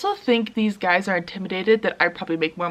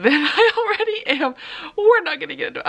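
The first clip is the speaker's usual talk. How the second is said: real laugh breaking into the words, pitched up; laughing to avoid crying; 6 semitones higher, faster than usual. Real, not acted. Admitting loneliness to viewers, laughing so she won't cry. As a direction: laugh it off before the tears come. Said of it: "I'm laughing because if I don't laugh".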